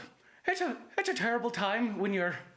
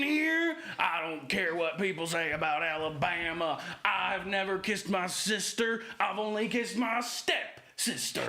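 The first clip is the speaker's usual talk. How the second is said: put-on Southern accent; 2 semitones higher than usual.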